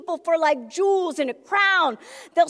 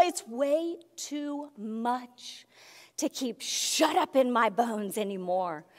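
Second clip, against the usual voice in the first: hushed intensity; 7 semitones lower, 9 dB quieter than usual. Real, not acted. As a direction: confide it, almost overwhelmed. Quiet intense confession.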